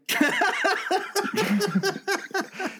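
long high-pitched laugh. Real, not acted. Listener laughing at the choking joke, amused.

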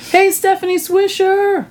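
high sing-song call; sing-song greeting. Pretend. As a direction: chirp it up high.

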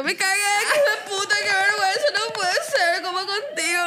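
high mock sobbing, like a small child; hammed-up tearful whine; little girl crying. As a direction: wail it in a put-on little-girl voice. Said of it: "Como niña chiquita".

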